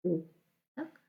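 ordinary talk

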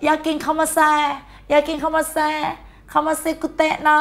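high, singsong mock-Japanese shop call; mock Japanese greeting. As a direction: chant mock Japanese high up, repeating evenly. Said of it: "พอมีคนเข้ามาในร้านอาหารก็บอกเลยค่ะ".

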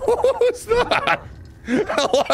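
high squeaky words breaking into laughter; incredulous, very high giggly voice; laughing in disbelief. Real, not acted. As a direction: squeal it out while cracking up. Laughing at a surprise event.

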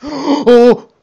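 sharp gasp then loud high oh; startled gasp. Real, not acted. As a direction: jump out of your skin. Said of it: "I'm fucking horrified".

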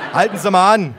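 jovial cop barking an order; bossy cop. Acted. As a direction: bark it like a cheerful cop. Cop gives order, voiced.

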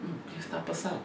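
soft, puzzled under-the-breath question; baffled murmur. Retold or imitated. Vlogger recalling his confused reaction, soft murmur. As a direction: mutter it to yourself, confused. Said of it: "eu tipo".

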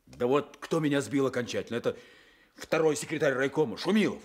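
loud, high indignant blame; bitter outburst. Acted. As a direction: spit out the name in anger. Blaming the district secretary.

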